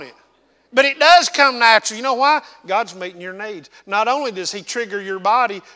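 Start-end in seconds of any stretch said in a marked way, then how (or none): 0.7-2.4 much higher and louder, building to a peak; rising preaching shout. Real, not acted. Preacher calling out to his congregation, louder and higher. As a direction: lift it up and call it out to the congregation.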